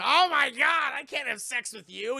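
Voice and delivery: high, loud wail of dismay; mock horrified shout. Buddy seeing his crashed lover walk out, mock horrified shout. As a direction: cry out in hammed-up horror.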